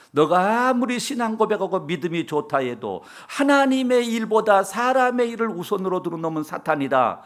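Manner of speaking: a sharp reproach from the master; stern rebuke. Acted. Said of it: "호되게 책망을".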